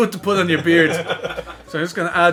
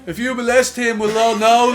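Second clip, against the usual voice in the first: high, loud mocking taunt, half laughing; jeering shout; 5 semitones higher, 6 dB louder than usual. Mates taunt the cop from a distance, loud laughing jeer. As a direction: shout it across the road to wind him up. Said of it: "they start shouting out things like".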